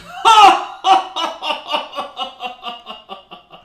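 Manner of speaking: big high hearty laugh, head tossed back; booming head-thrown-back laugh. Acted. Mon Mon laughing at the recruit's answer, booming and high. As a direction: roar with laughter at the trick question. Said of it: "throw back his head and laugh".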